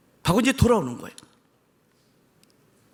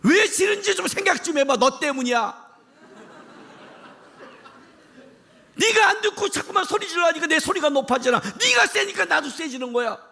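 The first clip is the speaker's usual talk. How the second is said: high, raised voice scolding the listener; a half-laughing high rebuke; preaching shout; 6 semitones higher than usual. Pretend. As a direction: answer the complaint with a high shout. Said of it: "왜 김남국 목사님은 소리할 때 저렇게 소리를 질러?".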